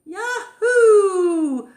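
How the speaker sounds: very high, loud laughing yahoo; joyful whoop. Acted. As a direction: whoop with glee. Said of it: "called Elmer".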